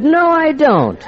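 high, slow, mock-innocent question; feigned innocence. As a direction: play dumb, drawn out and high. Mock quarrel, denying.

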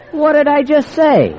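high, slow, mock-innocent question; feigned innocence. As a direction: play dumb, drawn out and high. Mock quarrel with wife, his voice.

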